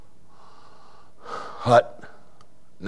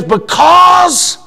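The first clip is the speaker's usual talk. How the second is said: preaching shout; 5 dB louder than usual. Retold or imitated. Preacher retelling his answer at full force. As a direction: lift it up and shout it. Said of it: "And I says".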